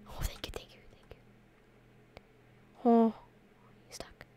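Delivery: breathy hushed whisper; whispering relief while hidden. Real, not acted. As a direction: whisper the thanks so nobody hears.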